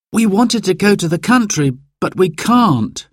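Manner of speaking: put-on high, disappointed young boy; a little boy explaining sadly; child telling his dad the news. A narrator's reading. Narrator voices child Simon.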